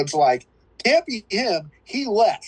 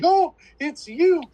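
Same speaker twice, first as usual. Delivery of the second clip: mock horror heroine; 11 semitones higher, 3 dB louder, slower than usual.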